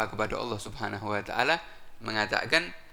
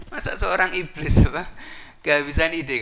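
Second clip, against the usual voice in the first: mocking disbelief; 5 semitones higher, 6 dB louder than usual. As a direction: drag out the disbelief loudly. Followers mock iblis.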